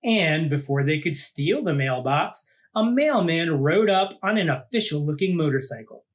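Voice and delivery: lively read-aloud storytelling voice; storybook narration. A narrator's reading. Host reading a monster picture book to kids, lively storytelling. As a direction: read it out like to a child at bedtime.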